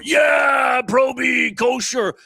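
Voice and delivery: high, drawn-out fan holler; mock crowd cheer. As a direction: whoop it like a rowdy crowd. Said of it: "People were".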